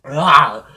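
loud pained cry; pained shout. Real, not acted. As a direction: yell as the burn hits. Burning from wasabi.

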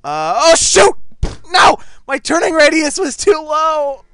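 loud, high shout of frustration; real frustrated yell; dismayed yelling. Real, not acted. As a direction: cry out over a blunder. Player dies in game.

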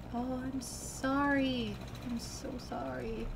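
slow, whiny condolence; drunken pity. Acted. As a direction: gush sympathy like a maudlin drunk. Drunk friend pities the bereaved one.

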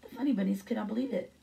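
ordinary delivery

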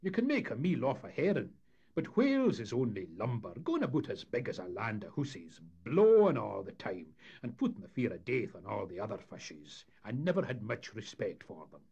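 loud Scots character voice; disdainful skipper. Acted. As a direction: play a skipper grumbling emphatically.

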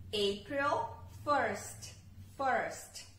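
slow, clear "April first" with "first" repeated; English date model. Shown as a demonstration. Teacher drills the date for students, slow and clear. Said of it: "Então, abril, primeiro de abril".

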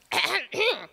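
a rough attempt to clear the throat. A narrator's reading. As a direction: hack to clear a dry throat. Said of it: "he tried to clear his throat".

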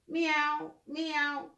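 light playful kitty meows; cat meow. Pretend. Cat noises in storybook.

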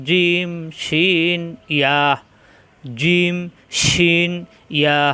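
"জিম, শিন, ইয়া" hard and separate off mid-palate; long vowels in "জিম" and "শিন", short firm "ইয়া"; firm articulation. Shown as a demonstration. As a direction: recite "জিম, শিন, ইয়া" firmly, letter by letter. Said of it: "শক্ত করে উচ্চারণ".

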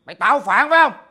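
indignant father laying down the law; angry scolding. Acted. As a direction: confront him, voice rising in volume. Father scolds son in acted quarrel.

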